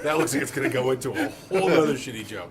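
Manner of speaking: talking through real laughter; laughing. Real, not acted. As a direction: let the laugh break into the line. Cracks up at another joke.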